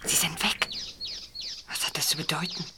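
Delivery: soft whispered question; puzzled whisper. Acted. Boy asking his friends while still hidden, whispering. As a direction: wonder aloud without being heard. Still hiding after the couple leaves.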